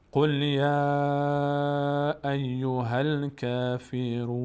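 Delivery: slow chanted recitation ending on a silent n; Quran recitation. Shown as a demonstration. Teacher reciting the full verse to students, slow and chanted. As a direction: recite the whole verse slowly, stopping on کافرون.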